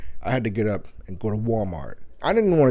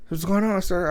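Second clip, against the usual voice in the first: polite staff voice; 8 semitones higher than usual. Retold or imitated. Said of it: "So somebody comes over".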